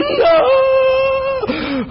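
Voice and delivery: high, loud anguished cry of no; dramatic high despairing shout. Retold or imitated. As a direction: wail it like the game is lost. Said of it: "fall down on my knees going".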